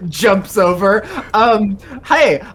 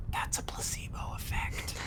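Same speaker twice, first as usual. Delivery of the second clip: hushed, voiceless aside; very soft secretive whisper; 22 dB quieter than usual. Acted. Solomon whispers to Tenrir, whispered. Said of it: "Solomon whispers to Tenrir".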